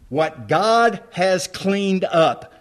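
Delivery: ordinary talk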